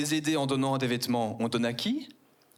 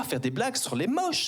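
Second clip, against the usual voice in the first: normal talking voice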